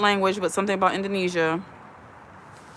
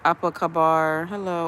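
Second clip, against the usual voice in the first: ordinary talk, nothing marked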